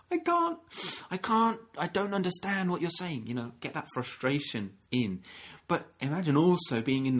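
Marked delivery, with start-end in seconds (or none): 0.0-3.2 put-on flustered child. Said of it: "And they're going".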